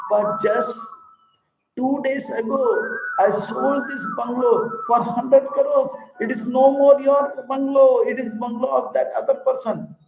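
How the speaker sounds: excited son. Acted. Son explains sale, voiced.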